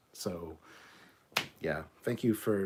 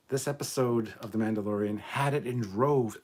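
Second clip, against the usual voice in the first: ordinary delivery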